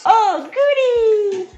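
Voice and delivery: very high, slow squeal of glee; high giddy squeal; squealed delight. Acted. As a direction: squeak it like a thrilled child.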